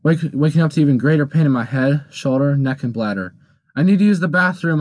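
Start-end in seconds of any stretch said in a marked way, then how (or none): 3.7-4.8 calling out. Retold or imitated. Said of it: "I called out".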